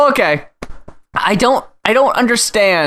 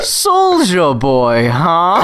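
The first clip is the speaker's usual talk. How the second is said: baffled executive; 3 semitones lower, much slower than usual. Retold or imitated. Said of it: "executive repeating it".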